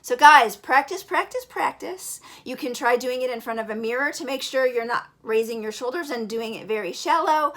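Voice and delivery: normal talking voice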